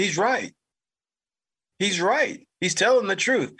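high, insistent backing; a touch of the cousin pleading his case; cousin insisting. Retold or imitated. Cousin siding with him to his mother, high and insistent.

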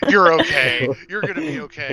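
mock-anxious reassurance, pitch climbing; willing someone okay. Imitating the shooter over the wounded player, willing them to be okay. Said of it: "she's just willing me to be okay".

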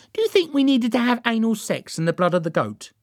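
high-pitched eager sidekick voice; Derek, eager. A narrator's reading. Derek suggests an extra rite to Joan, eager. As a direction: ask it high and hopeful, as the keen helper.

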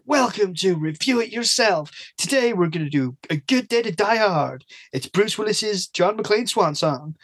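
mock show host, slow and drawn out; Quagmire. Shown as a demonstration. Podcaster demonstrating a jokey episode intro, cartoonish host voice. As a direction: stretch out the intro with exaggerated swoops. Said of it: "a bit like Quagmire from Family Guy".

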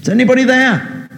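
high, fast, desperate call upward; shouting for help. Acted. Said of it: "So he shouted out".

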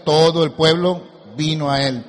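loud, leaning on "Todo"; emphatic answer. Shown as a demonstration. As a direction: hit "Todo" hard, as a prompt to repeat.

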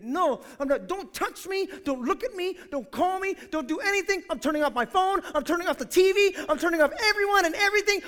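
mock indignant refusal, building; righteously indignant.

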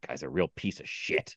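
low, soft, disgusted grumble; mock mad mutter. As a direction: grumble it under your breath like an annoyed old man. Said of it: "he just sounded so mad".

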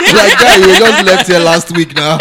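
loud, slow, laughing hard; teasing through loud laughter. Real, not acted. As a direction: laugh out the words in disbelief. Mocking returnee, cracking up.

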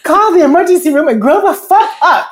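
scolding mother voice. Retold or imitated. Inner voice like her mother scolding her, loud and high. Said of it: "That's exactly what the voice was saying".

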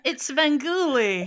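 slow, drawn-out announcer call. Pretend. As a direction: boom the name like a host intro. Naming the beloved horror host.

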